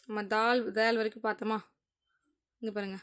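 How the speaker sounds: ordinary talk, nothing marked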